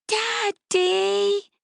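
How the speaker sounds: put-on high, protesting child's cry; a small girl wanting daddy back to normal; indignant little girl.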